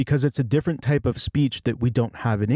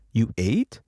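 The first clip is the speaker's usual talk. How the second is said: "You ate?" with "ate" swept upward; rising question tune. Shown as a demonstration. Teacher shows a question made by voice alone, rising tune. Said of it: "My voice is going up".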